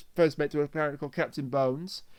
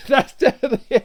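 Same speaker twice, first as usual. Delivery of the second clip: louder and higher, through real laughter; laughing; 5 semitones higher, 9 dB louder, faster than usual. Real, not acted. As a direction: say it while cracking up. Cracking up at a Twitter post.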